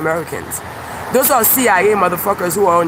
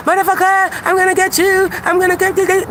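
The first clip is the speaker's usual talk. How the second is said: mock threat; 12 semitones higher than usual. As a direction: sputter it like a crazed troll. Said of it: "'Cause this is on quote".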